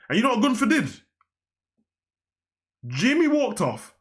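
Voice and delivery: can't believe it, voice jumping up; excited disbelief. Real, not acted. Telling viewers about the slap, high and excited.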